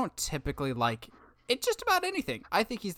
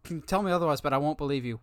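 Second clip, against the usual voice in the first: normal talking voice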